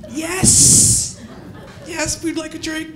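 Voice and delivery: forced politeness. Retold or imitated. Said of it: "And I'm like".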